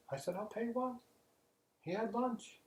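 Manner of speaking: very high, odd comic voice; punchline voice. Acted. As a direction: squeak out the punchline. Pagan punchline voice.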